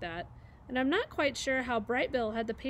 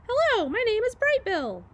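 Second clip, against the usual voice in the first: high, chirpy little bird voice; little gosling; 12 semitones higher, 8 dB louder than usual. Acted. Gosling introduces himself to a neighbour, high chirpy voice. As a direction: pipe it up cheerfully into the hole.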